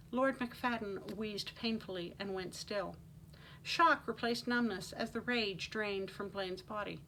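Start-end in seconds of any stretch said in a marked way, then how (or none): none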